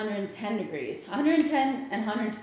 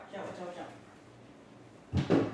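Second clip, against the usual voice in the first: breathy whisper; 4 semitones lower, 9 dB quieter, much slower than usual. Real, not acted. Warning the dog during fumes.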